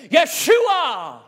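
Yeshua shouted high and loud in scorn; a jeering mob yelling Yeshua at fleeing David; mocking yell. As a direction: hurl the name like a taunting crowd. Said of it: "they're yelling at him".

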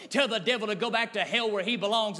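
ordinary talk

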